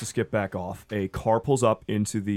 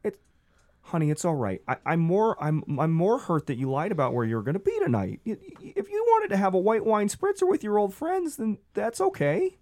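high, gentle disappointed; hurt husband; 8 semitones higher than usual. Acted. Gary telling his wife he is hurt by the lie, high. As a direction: forgive her but let the hurt show.

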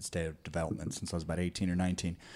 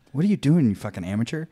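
louder, higher scolding; mock exasperation; 4 semitones higher, 6 dB louder, faster than usual. Scolding young bar kids who close tabs, mock exasperation. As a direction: scold the kid at the bar, hammed-up. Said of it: "And it's like".

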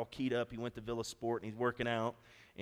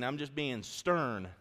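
ordinary talk, nothing marked

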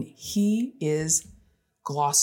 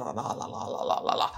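dismissive softer la-la; 10 semitones lower, 7 dB quieter than usual. Retold or imitated. Brushing off son's complaints.

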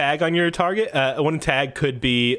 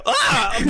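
high, sudden yelp; comic yell of someone tripped; mock falling yell; 9 semitones higher, 3 dB louder than usual. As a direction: cry out as you tumble, hammed up. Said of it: "and you hear, like, a".

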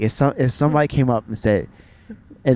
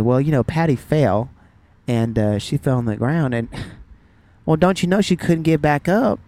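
storytelling Southern mom impression; deadpan news in a Southern twang; Southern accent. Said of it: "saying this in a Southern accent".

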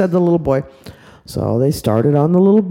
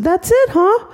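put-on very high childish question; little boy; 14 semitones higher than usual. Little boy asking if it's over, high. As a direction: ask like a small boy.

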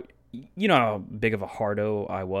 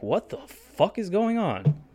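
slow, high incredulous question; imitated baffled crowd; mock bewilderment; 7 semitones higher, slower than usual. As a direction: stretch it out in disbelief. Crowd's bewildered reaction voiced.

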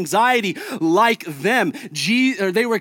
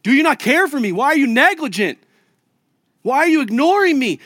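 raised, pleading accusation, louder and higher; accusing plea; 6 semitones higher, 5 dB louder than usual. Retold or imitated. Believers accusing Jesus, pleading loudly. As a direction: demand answers like a frightened believer. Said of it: "point the finger at Jesus and say".

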